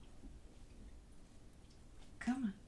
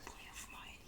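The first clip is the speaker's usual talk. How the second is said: whisper; 17 dB quieter than usual. Real, not acted. Worried owner watching dog slip.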